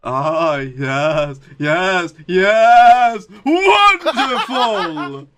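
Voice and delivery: ramp up into the wrestler catchphrase; mock wrestler voice. Joining the wrestler impression.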